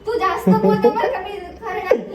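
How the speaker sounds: high, loud and laughing; laughing retort. Real, not acted. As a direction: laugh back at his teasing.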